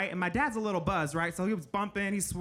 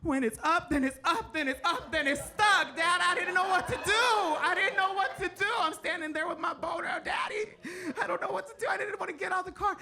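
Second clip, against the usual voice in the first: high, frantic pleading to dad; a flustered teen begging for help; panicked pleading; 8 semitones higher than usual. Retold or imitated. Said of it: "I was like".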